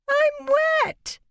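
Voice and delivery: put-on very high, loud shrieking little girl; wailing child. A narrator's reading. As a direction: shriek like a furious soaked child. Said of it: "She went into a high-pitched crying fit".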